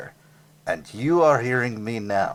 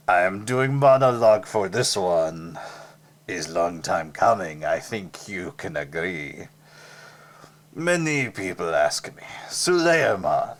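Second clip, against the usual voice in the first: put-on self-important oddball with an accent; proud, earnest storyteller who is misunderstood; grandiose character monologue; 2 semitones higher than usual. Suleiman addressing viewers, pompous accented monologue.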